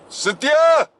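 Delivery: high, drawn-out holler; calling out. Retold or imitated. Injured man to his wife, calling out. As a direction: yell for someone across the house.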